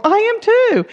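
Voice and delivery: delighted exclamation; excited. Retold or imitated. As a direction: burst out gleefully. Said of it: "I go".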